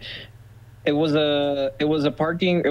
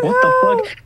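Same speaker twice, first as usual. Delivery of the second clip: shocked outcry; 7 dB louder than usual. Retold or imitated. Guy sees dent again.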